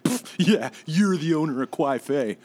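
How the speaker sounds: high mocking disbelief; sarcastic scoff. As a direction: scoff at her, sneering. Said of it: "he was like".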